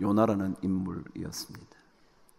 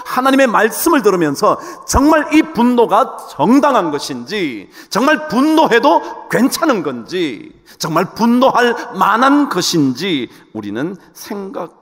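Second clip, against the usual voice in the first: raised, emphatic preacher's voice; forceful preaching; 8 semitones higher, 10 dB louder than usual. Acted. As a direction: preach it high and urgent.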